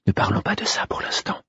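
hushed whisper. Acted. Molly hushes her lover in bed, whispering. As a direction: whisper it close, keep it secret. She hushes him, hand on mouth.

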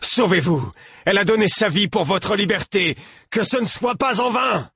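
high-pitched pleading shout, rising throughout; urgent yelling. A narrator's reading. Narrator begs the prince to escape, high urgent yelling. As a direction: urge it desperately in a high cry. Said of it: "hurlai-je au prince".

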